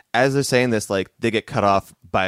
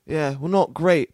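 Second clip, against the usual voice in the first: put-on higher childlike voice; a kid missing the old songs; wistful orphan kid; 6 semitones higher, slower than usual. Orphan kid telling Amadeus about his old songs, higher.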